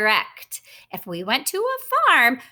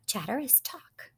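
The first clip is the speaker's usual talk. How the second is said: ordinary delivery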